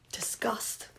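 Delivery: very soft, near whisper; hushed. Real, not acted. Reader describing Trevor's look, hushed.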